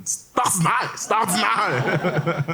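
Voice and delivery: high, very slow drawn-out "c'est ordinaire"; mock judge echo. Muttering the phrase to juror.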